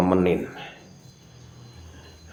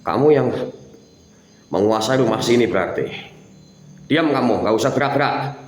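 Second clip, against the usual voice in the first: higher, louder stern commands; angry shout; 6 semitones higher, 5 dB louder than usual. Real, not acted. Ghost hunter ordering the spirit to stay still, angry shout. Confronting ghost in haunted house.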